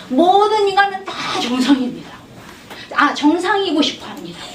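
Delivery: ordinary talk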